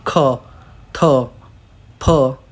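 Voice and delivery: ख, थ, फ with a puff of air; aspirated consonants. Shown as a demonstration. Teacher showing which sounds release air, aspirated ख, थ, फ. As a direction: breathe air out hard on ख, थ, फ. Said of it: "मुंह से हवा निकलती है".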